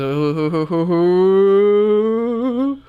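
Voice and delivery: rising loud hooting who; owl hoot. Pretend. Hooting who louder and higher.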